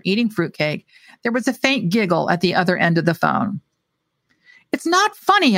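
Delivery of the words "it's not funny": sharp, loud and high; said hotly. A narrator's reading. Narrator rebuking giggling receptionist, heated reply. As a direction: snap back hotly. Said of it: "I said hotly".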